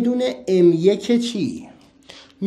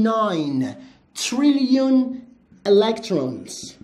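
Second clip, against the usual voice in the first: ناین تریلیون الکترونز said slowly and clearly; slow dictation; slower than usual. Shown as a demonstration. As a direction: dictate it slowly for the class to translate. (آرام و شمرده برای ترجمه)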